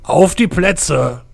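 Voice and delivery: slow, loud starting command; booming start call. Acted. The knight starts the race.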